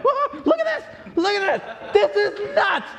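excited. Acted. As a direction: gush like you can't believe your eyes. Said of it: "she's getting more and more excited".

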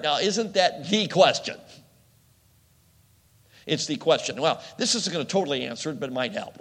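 normal talking voice

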